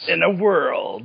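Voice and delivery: slow, dramatic movie trailer narrator; Don LaFontaine voice. Pretend. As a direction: intone it like an epic trailer. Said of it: "it would sound like Don LaFontaine".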